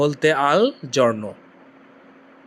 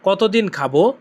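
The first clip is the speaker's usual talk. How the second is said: normal talking voice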